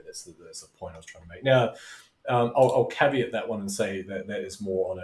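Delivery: usual speaking voice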